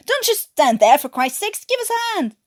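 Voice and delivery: loud, high panicked yell for help; trapped miner bellowing up a collapsed tunnel. Acted. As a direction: shout up the tunnel, desperate for hands. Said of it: "Davis's voice yelling".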